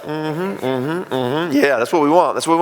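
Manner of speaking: small agreeing hums, lightly acted; mock-meek chorus of assent; boys behind mama. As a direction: hum along like kids backing up their mom. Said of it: "like, hiding behind mama".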